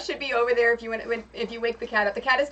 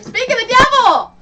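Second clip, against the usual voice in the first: much higher and louder, a bright greeting shout; excited call; 12 semitones higher, 13 dB louder than usual. Real, not acted. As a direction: call out gleefully as your friend walks in. Matt arrives, she calls out.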